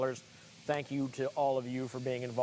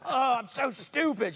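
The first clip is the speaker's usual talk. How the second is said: mock-frustrated, higher-pitched whine; fake frustration; 10 semitones higher, 3 dB louder than usual. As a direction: put on frustration for the wife. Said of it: "I'm smiling the whole time".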